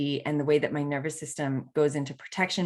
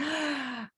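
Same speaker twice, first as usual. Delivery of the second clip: quick high gasp of delight; inspired gasp; 10 semitones higher, 6 dB quieter than usual. Retold or imitated. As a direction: gasp as if an idea just struck. Acting out sudden creative inspiration.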